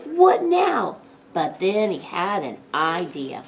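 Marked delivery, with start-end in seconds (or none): 0.0-1.0 high, loud exasperated groan; pitched-up despairing question. A narrator's reading. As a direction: groan it, at a loss. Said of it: "groaned Jake".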